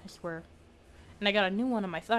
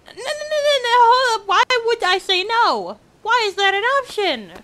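real crying, high and loud, slowed; 12 semitones higher, 9 dB louder than usual. Real, not acted. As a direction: choke the words out through real tears. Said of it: "can't, like, properly wipe off my tears".

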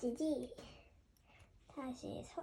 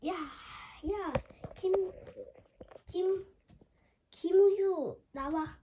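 calling out; 3 semitones higher, 6 dB louder, much slower than usual. Pretend. Teacher calling student out.

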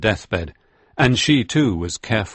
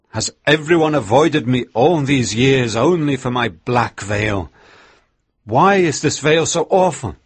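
higher, louder challenge from the deathbed; dying old minister; 4 semitones higher, 3 dB louder than usual. Acted. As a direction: rise up and confront the mourners. Father Hooper's deathbed speech.

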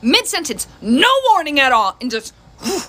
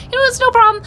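much higher, bright fake-cheerful "no problem"; mock sweet reassurance; 11 semitones higher than usual. Mom faking calm to her daughter about the pen. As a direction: chirp it with forced patience. Mom's sugary forced reassurance.

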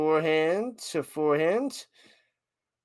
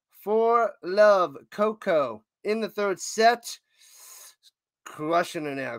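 ordinary talk

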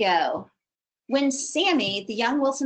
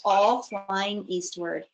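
ordinary delivery

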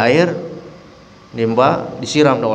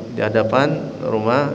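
ordinary talk

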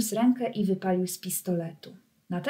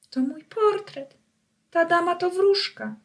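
higher, breathless with joy; excited recognition; 9 semitones higher, 4 dB louder than usual. Acted. Pinocchio recognising the fairy, excited and higher. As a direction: burst out in amazed delight. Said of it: "rzekł do siebie Pinokio".

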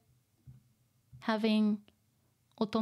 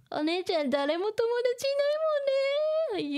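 put-on high teasing child voice; giggly little girl mocking her sister; 13 semitones higher than usual. Voicing little sister tease again.

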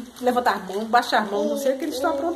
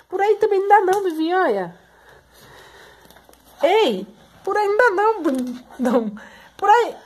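high, raised motherly warning; loud, insistent, pitched up; urgent correction; 7 semitones higher, 4 dB louder than usual. Real, not acted. Mother warns child off wrong way.

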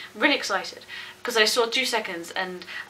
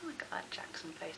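breathy secret whisper, lower; 8 semitones lower, 19 dB quieter than usual. Real, not acted. Fan whispering over her phone's teaser screen, hushed whisper. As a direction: hush it so nobody hears.

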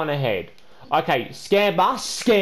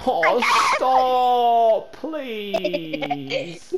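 pleading stop; 4 semitones higher, much slower than usual. Acted. Begging friend to stop.

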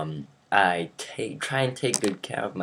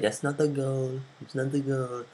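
higher-pitched put-on objection; referee imitation; 7 semitones higher than usual. Referee calling off the goal, higher put-on voice. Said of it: "He was like".

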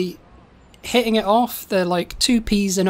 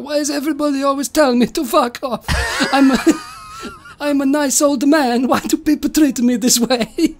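put-on high, plaintive Italian old man; Da Vinci voice; 6 semitones higher than usual. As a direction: wail in mock self-pity, half laughing. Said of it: "he's like".

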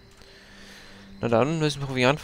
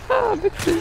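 much higher mock-amused squeak; sarcastic high laugh; 3 dB louder than usual. Real, not acted. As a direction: sneer at the game in falsetto. Sarkastisch zum Spiel. (sarkastisch hohe Stimme)

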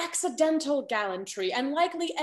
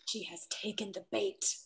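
whispered aside; 5 semitones lower, 9 dB quieter than usual. Acted. Aside to audience.

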